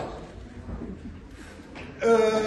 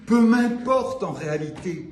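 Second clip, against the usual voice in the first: poem line restated in full voice; firm, projected recital; full-voiced declamation. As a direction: lift "Peu m'importe" back up and project it. Same words restarted in declaimed voice.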